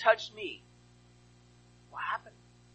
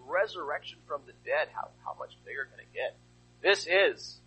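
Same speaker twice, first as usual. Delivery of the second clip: ordinary talk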